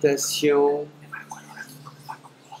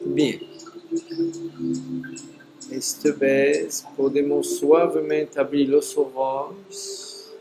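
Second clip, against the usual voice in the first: voz suave y pausada de meditación; guía el regreso con calma; meditación guiada. Acted. Closes the guided meditation. (voz serena de meditación)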